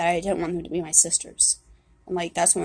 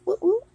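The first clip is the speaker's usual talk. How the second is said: imitated blower whoosh; 13 semitones higher, 3 dB louder than usual. Mimicking the fire blower.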